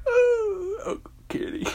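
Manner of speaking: high, loud, teary laughing words; a high, wailing laugh over the words; laugh-crying. Acted. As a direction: choke out the name through tears of laughter. Laugh-crying remembering Kenny.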